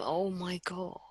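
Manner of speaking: embarrassed whisper. Real, not acted. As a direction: mutter it under breath. Embarrassed aside.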